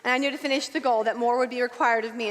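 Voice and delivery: usual speaking voice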